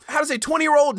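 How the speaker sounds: ordinary delivery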